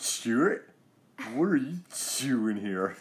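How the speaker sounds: stammered, slow parody of the sketch; Californians sketch voice. Retold or imitated. Dad imitating the Californians sketch to his son. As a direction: drag out the name like a soap surfer. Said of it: "He's like".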